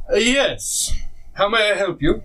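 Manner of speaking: a polite little shopkeeper offering help; shopkeeper's welcome. Acted. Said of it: "He says".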